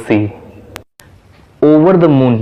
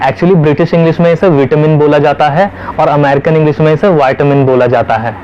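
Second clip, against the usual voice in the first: ordinary delivery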